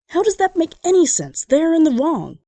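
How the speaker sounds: baffled protest; American outraged at the apology rule; incredulous. Real, not acted.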